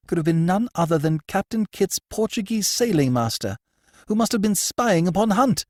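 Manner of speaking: high, urgent noblewoman; excited high lady's voice; agitated lady. Acted. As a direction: press him for news, voice high.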